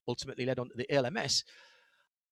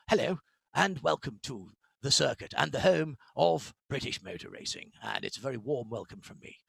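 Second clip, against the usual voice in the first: lower, softer mock received pronunciation announcer; posh RP announcer; 3 semitones lower, 4 dB quieter than usual. Mocking the establishment's ideal motorsport voice, in lower, softer posh RP. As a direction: send up the stiff old-school British circuit announcer in RP. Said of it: "you had to be talking like this".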